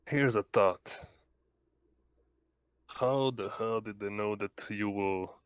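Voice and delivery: normal talking voice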